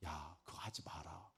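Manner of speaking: soft whisper of the Lord; gentle whisper. Retold or imitated. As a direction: hush it like a tender voice from above. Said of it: "주님 말씀하실 거 아니에요".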